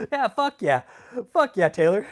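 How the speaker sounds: hyped agreement with Taylor; excited cheer. Real, not acted. Cheering with co-host.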